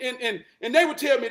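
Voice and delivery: normal talking voice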